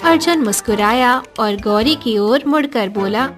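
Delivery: usual speaking voice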